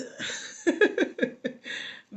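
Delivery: high-pitched wordless laugh. Real, not acted. Coach amused by her own busy and booked quip, laughing. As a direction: let out a high giggle at your own joke.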